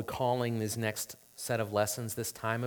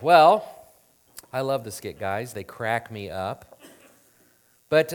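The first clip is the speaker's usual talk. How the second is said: ordinary talk, nothing marked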